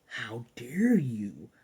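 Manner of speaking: slow, breathless whispered outrage; offended whisper. Retold or imitated. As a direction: whisper it as if deeply insulted. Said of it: "And he's like".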